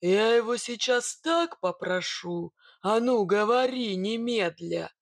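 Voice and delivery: drawn-out threat turning to a command; forceful, angry demand; menacing bogatyr. Acted. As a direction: threaten slowly, then order it out. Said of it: "зверем глянул на Сидора".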